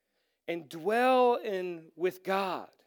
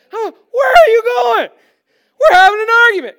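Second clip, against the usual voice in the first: high, loud outraged yell; heated argument; 13 semitones higher, 16 dB louder, faster than usual. Retold or imitated. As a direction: shout after someone walking out mid-argument. Acting out his heated argument.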